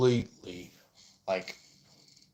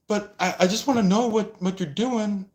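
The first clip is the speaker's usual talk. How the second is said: whiny insisting; 10 semitones higher than usual. Retold or imitated. Third party insisting.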